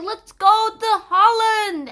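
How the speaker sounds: high, loud, drawn-out cheer; rally the group with mock excitement; excited rallying cry. Separatists deciding to flee to Holland, excited cry.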